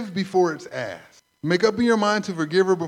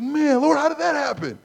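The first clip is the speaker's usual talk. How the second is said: amazed; 9 semitones higher, 4 dB louder than usual. Retold or imitated. Amazed at resolved argument.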